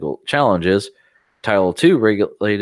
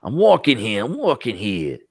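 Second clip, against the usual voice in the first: hammed-up New York accent. Joining the co-host's joke, mock New York accent. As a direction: play an indignant New Yorker, easing off on the repeat. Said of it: "And saying the New York accent".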